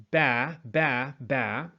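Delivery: ba repeated, lips closing for B; drilling the ba syllable; isolated syllable. Shown as a demonstration. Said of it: "When we say".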